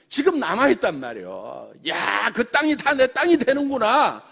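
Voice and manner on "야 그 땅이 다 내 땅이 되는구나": loud and high, a rousing sermon-style pitch; rally the room like a revival preacher; preaching at full force to the crowd; fired-up preaching. Acted. Climax of motivational speech.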